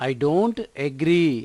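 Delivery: slow, word by word, clearly spaced; slow model sentence. Shown as a demonstration. Teacher to Telugu learners, modelling the English sentence slowly. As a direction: read it slowly for learners to copy. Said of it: "teacher models the phrase slowly".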